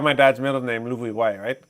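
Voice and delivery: normal talking voice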